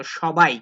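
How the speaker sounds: সবাই said as shobai with sh; teacher repeats সবাই as shobai; pronunciation demo. Shown as a demonstration. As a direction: say সবাই with a sh, not an s. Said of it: "এটার উচ্চারণ করবো আমরা তালব্য শ মতো".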